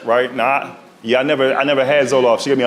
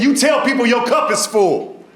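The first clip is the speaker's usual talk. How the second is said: doctor advice; 8 semitones higher, slower than usual. Retold or imitated. Voicing doctor giving advice.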